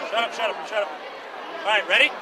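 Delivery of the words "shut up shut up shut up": usual speaking voice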